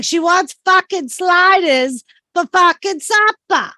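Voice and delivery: put-on high, emphatic, R-dropping Massachusetts accent; exasperated Massachusetts accent. Saying supper again for brother-in-law, exasperated Massachusetts accent. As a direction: hammer it out in broad Massachusetts accent. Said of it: "say it like we say it".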